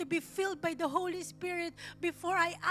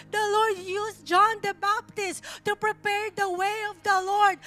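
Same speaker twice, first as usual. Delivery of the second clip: louder and higher, driving each line home; rising sermon climax, louder and louder; full-force preaching; 3 semitones higher, 9 dB louder than usual. Acted. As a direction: preach it out to the whole congregation.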